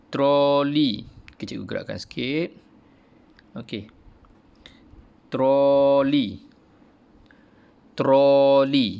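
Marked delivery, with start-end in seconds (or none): 0.1-1.1 "troli" loud, falling clearly; modelled word. Shown as a demonstration. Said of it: "Boleh sebut?". 5.3-8.9 "Troli" loud and high, falling; modelled word, said twice. Shown as a demonstration. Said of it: "Okey, boleh sebut?".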